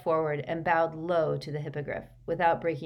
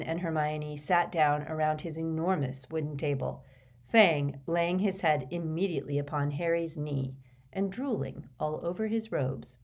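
usual speaking voice

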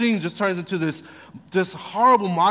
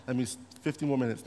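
mock pastor; 5 semitones lower, 8 dB quieter, much faster than usual. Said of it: "do the pastor thing".

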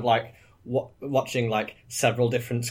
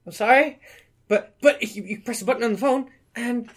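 high, squeaky, halting excuse; sheepish high-pitched stammering; flustered apology; 12 semitones higher than usual. Acted. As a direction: plead innocence in a high voice. Flustered excuse when scolded.

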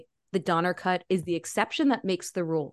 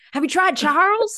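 higher, mock-incredulous quoted suggestion; acted movie quote; 10 semitones higher, 4 dB louder than usual. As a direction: imitate a character tossing out a silly suggestion. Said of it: "And he goes".

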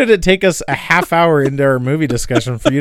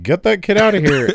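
imitating the heckler; 5 semitones higher than usual. Co-host echoing the dude's funny demand, light mock heckle. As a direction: mimic a heckle, half laughing. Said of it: "such a funny way".

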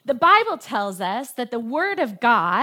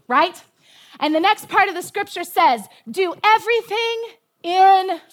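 rising, louder preacher's exhortation; preaching; 5 semitones higher, 4 dB louder than usual. Acted. Preacher exhorting her congregation, fervent and loud. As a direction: drive the scripture home to the church.

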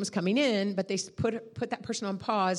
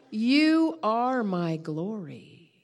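very slow, weighty declaration; voice of God; much slower than usual. Retold or imitated. The Lord answers the guilty preacher, solemn voice of God. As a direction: draw out each word with solemn authority. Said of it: "he said to me".

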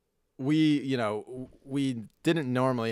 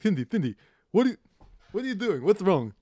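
high put-on voice of a puzzled dad; imitating dad; 9 semitones higher, 5 dB louder, faster than usual. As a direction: mimic dad calling out to his wife, pitched up. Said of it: "comes down and he's like".

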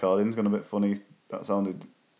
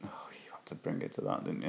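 unvoiced, much softer mock scold; whisper; 5 semitones lower, 14 dB quieter than usual. Real, not acted. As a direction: hush it like a sly reproach. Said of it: "mock scolding whisper".

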